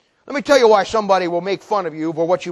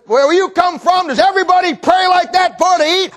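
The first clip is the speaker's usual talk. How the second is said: jeering holler; 9 semitones higher, 3 dB louder than usual. Retold or imitated. Trucker mocks old man's praying, jeering holler. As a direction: imitate a trucker mocking for the whole room.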